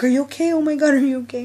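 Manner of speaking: loud, high, worried checking; alarmed concern. Retold or imitated. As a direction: rush to check on her. Friend hit her head.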